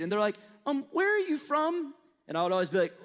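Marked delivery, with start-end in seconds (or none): 0.7-1.9 put-on higher, slower curious kid; a child's wondering question; imitating a child. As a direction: drag it out like a puzzled student. Kids asking where he's from.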